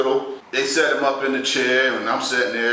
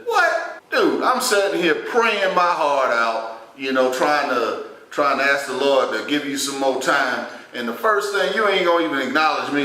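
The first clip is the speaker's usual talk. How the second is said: exasperated disbelief; 4 semitones higher than usual. Retold or imitated. Nephew scolding his bedridden uncle for ignoring his prayers, exasperated disbelief. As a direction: retell it in incredulous, mock outrage. Mock outrage at sick uncle.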